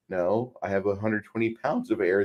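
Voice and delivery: ordinary talk, nothing marked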